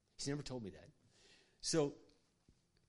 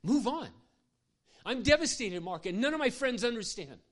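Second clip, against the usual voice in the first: much higher and louder, anguished; devastated outcry; 11 semitones higher, 10 dB louder than usual. Retold or imitated. Horse girl telling pastor her grief, loud and anguished. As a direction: cry it out in disbelief. Grieving girl's outrage.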